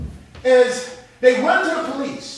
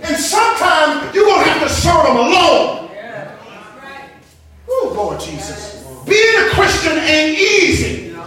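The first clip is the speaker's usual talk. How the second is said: raised preacher's voice, pressing each phrase; full-force preaching; 5 semitones higher, 7 dB louder than usual. Real, not acted. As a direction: preach it out to the whole congregation.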